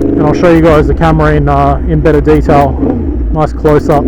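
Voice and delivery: muffled voice inside a motorcycle helmet; talking into a helmet mic while riding. Real, not acted. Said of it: "I've got the visor closed on the Ruroc Atlas".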